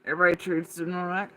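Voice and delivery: normal talking voice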